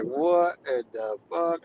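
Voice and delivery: drawn-out, dumbfounded reaction; slow disbelief. Retold or imitated. Streamer reacting to deportation comments, slow disbelief. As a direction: stretch each word in disbelief.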